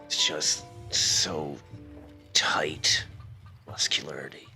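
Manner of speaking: low, slow, strained near-whisper; squeezed in a hug. Acted. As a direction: murmur slowly as if squeezed too tight. Strained words inside a hug.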